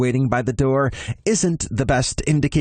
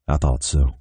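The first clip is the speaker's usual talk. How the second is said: lower, even Texan man's voice; Noel, calm Texan boyfriend; 7 semitones lower than usual. Acted. Noel telling Ben to go back to Austin, resigned and low. Noel's voice.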